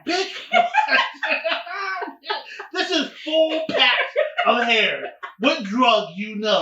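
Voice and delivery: hammed-up outraged retort; mock indignant yell. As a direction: yell it back through laughter. Retold comeback in a drug-deal story.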